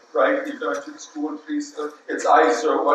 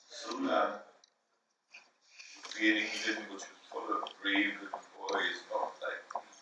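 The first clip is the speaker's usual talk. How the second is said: much softer and lower; fading to nothing; 9 semitones lower, 16 dB quieter than usual. Acted. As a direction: let it die away in shame. Child's ashamed trailing confession.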